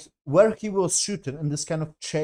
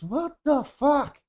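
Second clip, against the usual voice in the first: exasperated disbelief; 10 semitones higher, much slower than usual. Real, not acted. Ranting about the episode.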